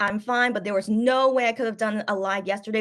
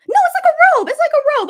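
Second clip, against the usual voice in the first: surprised; 10 dB louder than usual. Real, not acted. Realizing the outfit is robe.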